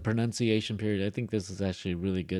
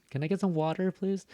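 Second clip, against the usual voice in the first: California accent; 9 semitones higher than usual. Shown as a demonstration. Teacher modelling a request for learners to practise, California accent. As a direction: ask lightly in a California accent. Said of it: "say it like that".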